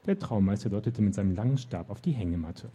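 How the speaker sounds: usual speaking voice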